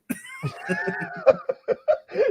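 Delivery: a squealing, high burst of real laughter; high laughing fit. Real, not acted. Laughing at his own skin-tight shirt, high squeal. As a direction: crack up high at the joke.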